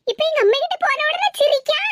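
ordinary talk

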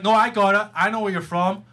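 ordinary delivery

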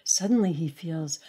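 ordinary delivery, nothing marked

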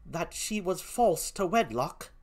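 put-on softened British-accented disbelief; quiet questioning in a British accent; Emilia. Gentlewoman questions the accusation, softly in a British accent. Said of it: "please forgive my poor British accent".

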